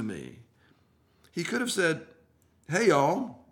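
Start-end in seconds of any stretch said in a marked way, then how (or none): 2.7-3.4 a light Southern drawl, higher and louder; friendly Southern greeting, pitched up; Southern accent. Pretend. Preacher imagines Jesus as a Southerner, light Southern accent. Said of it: "if Jesus was a Southerner".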